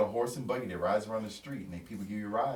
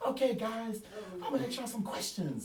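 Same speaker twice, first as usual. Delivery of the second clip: high, eager presenter voice; energetic speaker; 11 semitones higher than usual. Retold or imitated. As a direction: hype it up like a keynote speaker. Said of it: "highly energetic guy".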